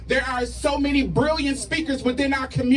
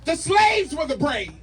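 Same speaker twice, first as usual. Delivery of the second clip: rally speech; 3 semitones higher than usual. Acted. Spoken word rally poem, shouted.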